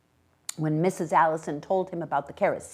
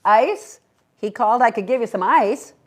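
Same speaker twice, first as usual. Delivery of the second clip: calling out; 6 semitones higher, 8 dB louder than usual. A narrator's reading. Ice man calling from truck.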